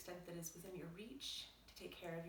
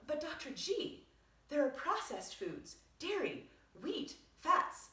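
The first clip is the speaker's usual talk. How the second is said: a hint of a higher, louder, alarmed patient; shocked patient; 5 semitones higher, 8 dB louder than usual. Patient protests the meal plan to Doctor G, mock alarm. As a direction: play the patient protesting, lightly voiced. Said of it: "react in shock".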